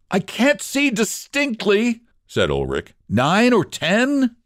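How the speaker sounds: drawn out, peering into the dark; Ulrich's voice. Acted. Ulrich guesses the rescuers' number, slow high voice.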